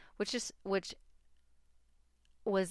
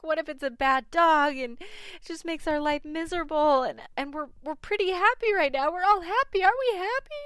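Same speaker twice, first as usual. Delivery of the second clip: higher and louder, pitch climbing to a near wail; freaking out; 11 semitones higher, 8 dB louder than usual. Retold or imitated. Worried a bad dog will ruin their happy family, climbing panic. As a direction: spiral into panic, rising with every question. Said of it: "I was just sort of freaking out".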